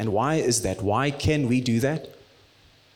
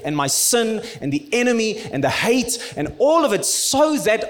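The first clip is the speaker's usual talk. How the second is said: raised, ringing sermon voice; impassioned preaching; 7 semitones higher, 3 dB louder than usual. Acted. Pastor building to the cross, full-force preaching. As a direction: preach it out with fire.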